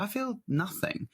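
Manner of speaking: mock-deadpan, drawn out; deadpan quote. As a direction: say it like you feel nothing at all. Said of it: "I was like".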